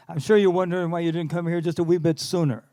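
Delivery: a light Irish lilt on the manager's words; Irish accent. Retold or imitated. Irish manager reassuring the young singer, in a light Irish accent. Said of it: "Irish accent".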